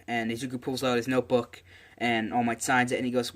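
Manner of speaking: ordinary delivery, nothing marked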